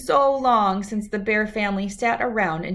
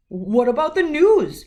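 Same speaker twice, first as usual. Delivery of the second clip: louder, higher indignant protest; protesting Papa; 7 semitones higher, 7 dB louder than usual. Acted. Papa protesting to Mama about missing the news, louder. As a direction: object like you've been robbed. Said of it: "protested Papa".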